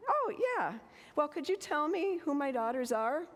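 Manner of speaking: put-on high, puzzled elderly mother voice; voicing confused mother. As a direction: pitch way up, lightly questioning.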